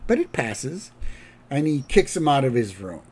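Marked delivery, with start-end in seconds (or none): 0.1-0.9 slow, higher-pitched brush-off; lightly voiced unbothered official; dismissive director. Director waving off Grandpa's complaint, dismissive. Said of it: "the director goes".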